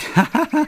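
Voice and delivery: very high, loud burst of laughter; teasing laugh. Acted. Earthling joking about parents' colours, high laugh. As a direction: crack up high at your own joke. Laughs after repeating the joke.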